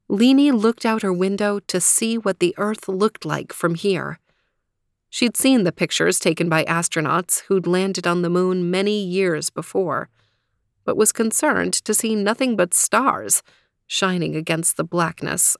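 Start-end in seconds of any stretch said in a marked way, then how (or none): none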